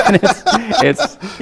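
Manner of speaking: breaking into laughter mid-sentence; laughing. Real, not acted. Laughing over the character.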